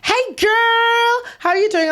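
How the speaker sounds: high, hammed-up American girlfriend greeting; exaggerated, high-pitched mock gushing. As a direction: squeal hello, stretching out "girl". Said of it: "for example, someone goes".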